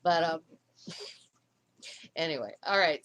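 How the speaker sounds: ordinary delivery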